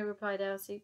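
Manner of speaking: ordinary delivery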